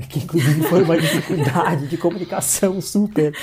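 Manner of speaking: talking through a real laugh; amused, chuckling as you speak. Real, not acted. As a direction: laugh your way through the words. Laughing while recalling language barrier.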